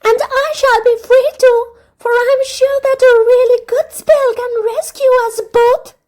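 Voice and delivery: a princess sure she will be freed; hopeful princess. A narrator's reading. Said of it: "cried Princess Star".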